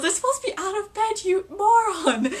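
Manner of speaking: high, loud exasperated retort; mock stern professor; imitating McGonagall. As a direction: scold the fool impatiently. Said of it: "And then McGonagall goes".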